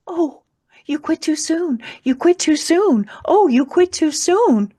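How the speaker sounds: a sorrowful angel lamenting, crying; a different gentle voice. Retold or imitated. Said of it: "I hear a completely different voice".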